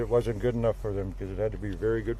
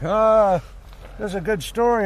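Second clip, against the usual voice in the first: high, louder breezy dismissal; mocking Duncan; 10 semitones higher, 4 dB louder, slower than usual. Buddy brushing off the swallowed nugget, high and loud. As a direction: brush it off airily, pitched up.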